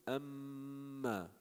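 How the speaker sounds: amma with the mm held long through the nose; slow demo of amma with a long humming mm; held nasal mim. Shown as a demonstration. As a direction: stretch the mm in amma slowly. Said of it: "goes from the lips".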